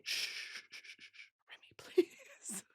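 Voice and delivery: unvoiced hushing; calm Remy down in a whisper; shushing whisper. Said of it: "And I say".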